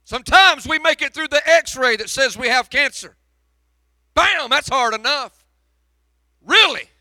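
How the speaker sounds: loud, high, pleading preacher's cry; preaching at full force. Acted. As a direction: hurl the questions at heaven, full force. Building sermon climax.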